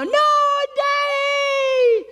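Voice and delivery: put-on very high wailed child's refusal; protesting kid. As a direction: wail like a clinging little kid. Acted kid refusing to let go.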